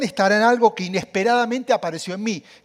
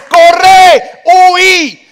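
high, loud panicked yell of alarm; panicked shout; 11 semitones higher, 17 dB louder than usual. Acted. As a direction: shout it like your brain screaming run. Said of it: "te entra en pánico y dice". (grito de pánico, agudo y fuerte)

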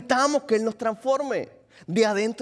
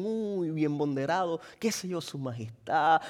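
mock flattery; 4 semitones lower, 6 dB quieter than usual. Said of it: "de repente te dicen".

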